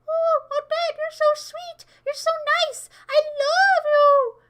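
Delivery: high, loud, gushing mock child voice. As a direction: squeal adoring praise at Dad. Voicing someone praising Dad.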